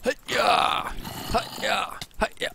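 high, shouted attack grunts; battle cry. Real, not acted. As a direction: yell with every sword swing. Attack cries while swinging sword.

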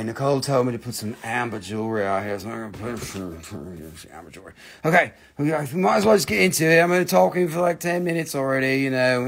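ordinary talk, nothing marked

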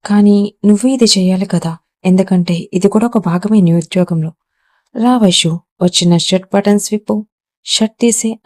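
usual speaking voice

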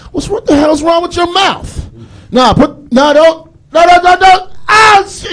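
high, loud panicked no-no-no; frantic high shouting in mock terror; panicked yelling. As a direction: scream it like the vampire is lunging at you. Panicked reaction to a vampire.

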